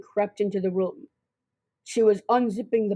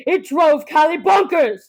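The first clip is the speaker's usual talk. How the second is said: exclaiming; 7 semitones higher, 7 dB louder than usual. A narrator's reading. Narrator builds to Callie's breaking point.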